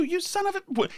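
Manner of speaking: high, softened imitation of an angry woman; mock yell. Woman yelling at the runaway, high mock anger. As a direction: curse in a high, held-back mock yell. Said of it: "this woman is yelling too".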